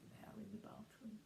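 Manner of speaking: hushed stage whisper. Real, not acted. Reading to a child, whispered aside. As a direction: lean in and whisper it.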